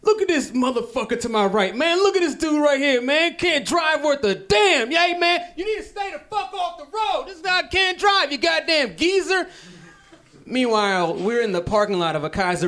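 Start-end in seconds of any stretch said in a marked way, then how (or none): 0.0-9.5 put-on old dad ranting. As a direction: imitate dad cursing a driver from a parked car. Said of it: "he's like".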